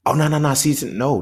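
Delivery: a gift-giver rushing to insist nothing's wrong; flustered protest. Retold or imitated.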